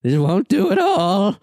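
high, very slow disdainful drawl; the sorceress frowns as her victim resists; disappointed sorceress. Acted. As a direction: drag it out, coolly displeased. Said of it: "she says".

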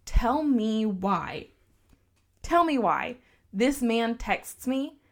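drawn-out, heavy emphasis on each word; slow it down to build suspense; slow dramatic build. Real, not acted. Telling friends about his text, slow dramatic build. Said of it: "dramatic buildup to reveal".

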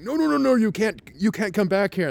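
high, loud mock alarm; alarmed objection. Staff acted stopping him at bar.

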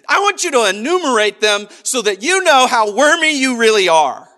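over-the-top condemning voice; mock scolding God. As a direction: drive every accusation home, hammed up. Mock God rubbing in sins.

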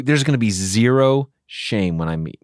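usual speaking voice

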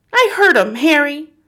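high, loud, panicky insistence; frightened shriek. A narrator's reading. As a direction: shriek it at your husband in fear. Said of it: "the mother shrieked, still quaking".

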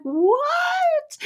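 very high, stretched disbelieving what; shocked what. Retold or imitated. Host retelling her reaction to freeze-dried pickles, very high. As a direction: squeak out your disbelief. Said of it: "I'm like".

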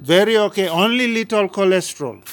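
loud, high Indian doctor voice; Indian accent. Retold or imitated. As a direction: imitate the Indian doctor's English. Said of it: "in their Indian accent".

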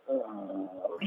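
long tired sigh of disgust; weary old man groan. Retold or imitated. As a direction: exhale like a deflating toy. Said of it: "look out the window and go".

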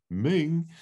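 high-pitched Brummie question; hammed-up high Brummie; Brummie octopus. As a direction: query it in a silly Brummie voice. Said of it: "the octopus says".

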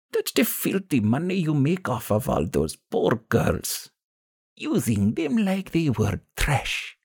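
put-on slow, high, accusing old woman; disgusted old crone, high and drawn out; gravelly old woman. A narrator's reading. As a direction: condemn them slowly as a gravelly old woman. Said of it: "The old woman's gravelly voice".

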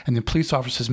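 normal talking voice

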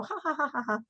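dismissive "ha ha" imitation; mock laughter. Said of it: "they all laugh and go".

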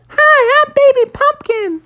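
put-on very high, loud squeaky baby pumpkin; Sister's pumpkin greeting everyone in a tiny high squeak. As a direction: chirp it like a tiny new pumpkin. Sister's pumpkin comes to life.

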